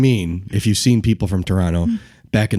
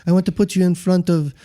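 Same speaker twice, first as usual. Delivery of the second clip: higher, gentle, considerate imitation; put-on soothing voice, pitched up; Joe Haas; 7 semitones higher than usual. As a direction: mimic a passive man kindly explaining. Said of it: "very quiet and passive in the way he talks".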